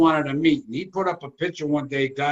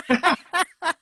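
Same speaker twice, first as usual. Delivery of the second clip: laughing; 14 semitones higher than usual. Real, not acted. Laughing at friend's odd video look.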